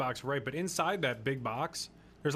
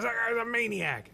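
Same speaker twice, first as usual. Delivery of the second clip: mock outrage, higher and louder; annoyed driver; 11 semitones higher, 5 dB louder than usual. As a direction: play the indignant driver behind the wheel. Mocking irritated driver.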